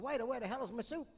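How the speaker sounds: lightly voiced cranky old man; impatient old man. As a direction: snap at the waiter like a grumpy old man. Old man complains to waiter.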